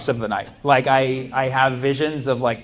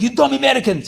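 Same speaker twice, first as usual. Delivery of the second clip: high, loud mock Ukrainian professor rant; Ukrainian professor yelling; 11 semitones higher, 4 dB louder than usual. Professor yelling at dumb American students, mock angry accent. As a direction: bark it at the class like an angry foreign professor. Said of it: "He's like".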